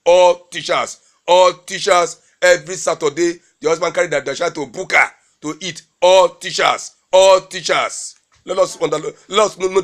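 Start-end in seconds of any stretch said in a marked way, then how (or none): 0.0-2.2 ALL TEACHERS hammered out louder, then repeated; underlined ALL TEACHERS. Real, not acted. Complaining that the wives don't cook, loud stress on ALL TEACHERS. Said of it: "Underline the word all teachers". 6.0-8.2 ALL TEACHERS loud and emphatic, the repeat drawn out; underlined ALL TEACHERS. Real, not acted. Complaining about husbands feeding children at the buka, hard stress on ALL TEACHERS. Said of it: "Underline the word all teachers".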